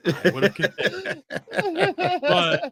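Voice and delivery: high, slow words through real laughter; laughing. Real, not acted. Laughing at own joke.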